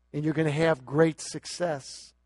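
ordinary talk

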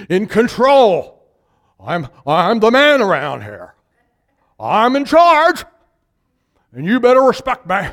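preacher voicing a domineering husband, loud. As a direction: bark it like the big man.

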